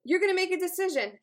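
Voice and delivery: loud, emphatic resolve; fired up. Acted. Listener vowing to stop over-apologizing, fired up. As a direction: declare it with force, fed up. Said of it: "fired up with a passion".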